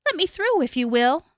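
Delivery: high, polite little voice; little goat. A narrator's reading. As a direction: ask to pass as a small goat. Little goat asks to pass.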